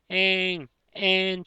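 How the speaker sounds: put-on high childlike wailing of "えーん"; a little kitten sobbing "えーん、えーん" in a high voice; mock crying. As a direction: wail like a small crying kitten. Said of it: "と鳴き声が聞こえてきました".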